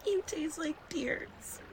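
slow soft whisper; tender whisper. Acted. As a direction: whisper it lovingly. Tender moment in boat.

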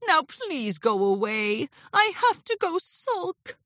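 sulky dismissal. Acted. Sulky Discord dismisses his visitor.

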